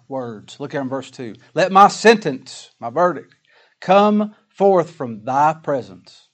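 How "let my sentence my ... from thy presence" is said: slow, loud scripture read; solemn psalm reading. Acted. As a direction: draw out each word of the verse. Reads verse two.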